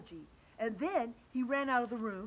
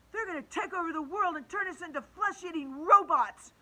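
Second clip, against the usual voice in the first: higher, louder panicked shout; 8 semitones higher, 8 dB louder than usual. Acted. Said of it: "I shouted".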